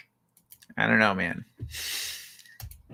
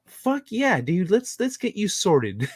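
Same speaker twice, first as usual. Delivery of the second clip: much higher, hyped and ready to go; pumped-up helper; 9 semitones higher, slower than usual. Retold or imitated. The helpdesk worker rallying to help her caller, hyped. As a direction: sound like she can't wait to fix it. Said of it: "I could hear it in her voice".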